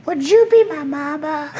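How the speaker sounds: very high, pleading duckling; squeaky duck begging for a mama; Bono Duck. Acted. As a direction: ask sweetly like an orphan duck. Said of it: "which I named Bono Duck".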